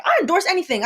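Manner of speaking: high, bright bragging tone; mock [name] voice. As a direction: say it like a proud spokesman. Imitating [name] quote.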